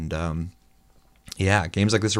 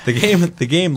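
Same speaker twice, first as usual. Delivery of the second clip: words bubbling out through a laugh; 10 semitones higher, 8 dB louder than usual. Real, not acted. Laughs while starting.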